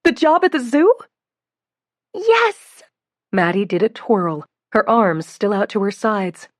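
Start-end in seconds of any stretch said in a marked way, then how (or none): 0.0-0.9 high, eager question; thrilled mother checks; excited mother. A narrator's reading. Mother hearing the news. 2.1-2.8 very high, loud yes; ecstatic yes. A narrator's reading. Announcing news to family.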